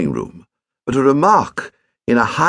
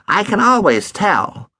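higher, smug and slightly fast; complacent boy; 5 semitones higher than usual. A narrator's reading. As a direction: innocent act, self-satisfied. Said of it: "said his stepson complacently".

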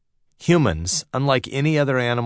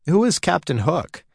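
put-on interested young Peter; 8 semitones higher than usual. A narrator's reading. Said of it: "he asked with interest".

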